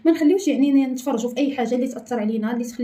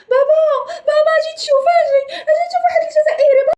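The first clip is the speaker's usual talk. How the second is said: high sobbing calls, loud; crying out for dad; 5 dB louder than usual. Acted. Crying child calling his father to come see.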